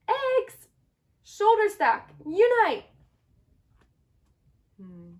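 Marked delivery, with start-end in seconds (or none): 0.1-2.8 high, loud battle cry; rally the team like a hero; rallying call. Acted. Shell rallying the eggs to stack up, loud battle cry.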